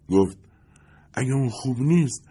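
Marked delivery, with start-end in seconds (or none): 1.1-2.3 higher, lightly voiced glamorous woman; a hint of a starlet, pushing for gossip; Lindy's voice. Lindy pressing the narrator about Jake, lightly voiced higher woman.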